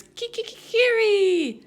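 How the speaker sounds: high, loud crowing rooster call. Acted. Waking Anna in the story, a high loud rooster crow. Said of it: "to the rooster's".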